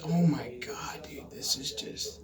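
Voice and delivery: stunned whisper at the screen; exasperated whisper. Real, not acted. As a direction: breathe it out in disbelief. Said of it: "whispered exasperation".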